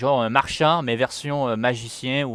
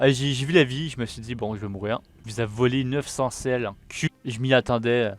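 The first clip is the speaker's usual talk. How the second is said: usual speaking voice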